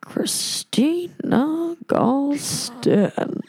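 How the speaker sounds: slow, high, stretched-out roll call; drawn-out name call. Retold or imitated. Guard calls her name in a slow, stretched-out roll-call voice. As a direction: drag the name out like a bored guard.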